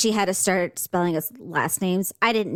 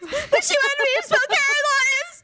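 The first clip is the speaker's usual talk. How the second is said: high, hammed-up tearful wail; upset wailing. Child tells mom she lost the spelling bee, mock wailing. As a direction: bawl it like a child in mock despair. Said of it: "I was so upset".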